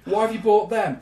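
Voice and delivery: mock-angry dad on a road trip; exasperated parent. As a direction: scold the family in the car. Acted family car quarrel.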